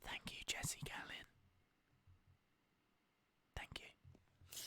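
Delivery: soft breathy whisper. Shown as a demonstration. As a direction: whisper the thanks. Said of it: "I need a whispering".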